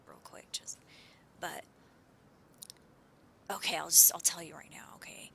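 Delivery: hushed, secretive whisper. Real, not acted. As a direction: lean in and whisper it.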